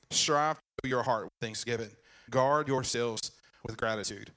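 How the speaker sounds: ordinary delivery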